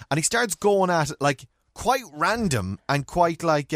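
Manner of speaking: ordinary talk, nothing marked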